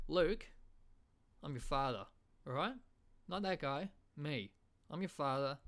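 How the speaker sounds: casual, blurted Aussie dad; Australian accent. Acted. Aussie father setting Luke straight, broad casual Australian. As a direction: say the movie line as an offhand Australian. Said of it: "in an Australian accent".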